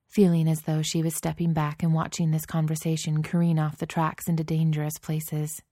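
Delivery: ordinary delivery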